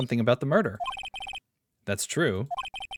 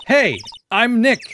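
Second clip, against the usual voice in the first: said higher, louder and slowly into the camera mic; 8 semitones higher, 7 dB louder, slower than usual. Real, not acted. Nick trying to trigger a sound camera, calling loudly. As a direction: call out to set off a camera. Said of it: "Maybe I'm not saying it loud enough".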